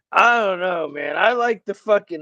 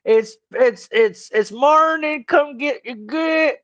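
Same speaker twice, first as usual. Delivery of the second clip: high, slow hollered drawl; mock Southern holler; 7 semitones higher, 3 dB louder, slower than usual. Teasing Ryan with a hollered country drawl, high and slow. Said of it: "yell at your dude like".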